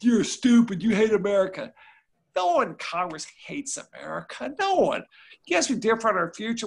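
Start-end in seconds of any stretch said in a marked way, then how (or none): 0.0-1.7 louder, scornful Georgia-free jab of a partisan attacker; mock insult. Angry partisan mocking the other party, loud insult. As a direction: hurl it like a cheap shot at a rival. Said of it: "both parties would say".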